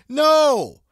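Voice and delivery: sharp shouted no; loud no. Real, not acted. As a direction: shout it down hard. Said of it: "rejects the alternative booking".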